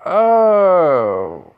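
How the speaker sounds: loud, high drawn-out "oh"; sudden realization. Acted. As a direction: let the realization swell, then slide down. Naruto suddenly remembers a visitor.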